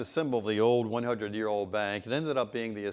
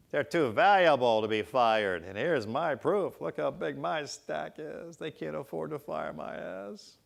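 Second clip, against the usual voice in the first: lightly voiced cocky worker, higher and slow; a hint of smug bragging; 6 semitones higher, slower than usual. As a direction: show off your stack like a proud lout. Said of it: "Bragging rights".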